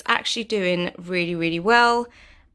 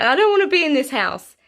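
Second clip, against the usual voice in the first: whiny plant voice, higher, louder; voicing the plant; 6 semitones higher, 5 dB louder, faster than usual. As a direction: sulk like a dying plant, fast. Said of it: "It was like".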